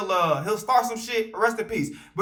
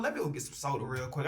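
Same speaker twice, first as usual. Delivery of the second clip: relaxed mumble; 9 semitones lower, 12 dB quieter than usual. Retold or imitated. Relaxed inner thought quote.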